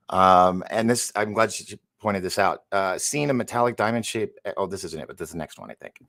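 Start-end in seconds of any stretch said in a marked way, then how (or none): none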